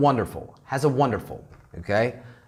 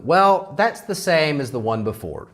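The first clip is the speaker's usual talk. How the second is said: ordinary talk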